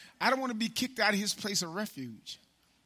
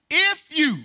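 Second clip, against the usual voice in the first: loud call; 10 semitones higher, 13 dB louder than usual. Real, not acted. Preacher calling the congregation with a loud, high shout.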